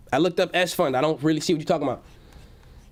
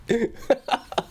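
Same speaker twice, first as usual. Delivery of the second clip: high real laugh rising; cracking up again; 5 semitones higher than usual. Real, not acted. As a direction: keep laughing at the joke. Still laughing at the comment.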